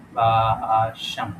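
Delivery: very slow, stretched باشم; drawn out. Shown as a demonstration. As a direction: say باشم slowly, lengthening the first syllable. Sounding out the answer word.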